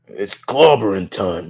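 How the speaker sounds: low Brooklyn catchphrase; deepened voice, Brooklyn accent; Ben Grimm. Acted. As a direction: deliver it as a deep Brooklyn bruiser. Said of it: "straight Brooklyn accent coming out of him".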